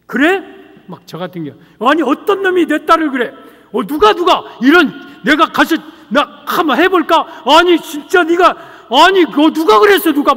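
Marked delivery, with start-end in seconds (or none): 0.1-0.4 indignant father. Acted. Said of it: "내가 화를 내잖아요". 1.8-10.3 loud, high, outraged protective dad; angry father. Acted. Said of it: "막, 막 내가 화를 내잖아요".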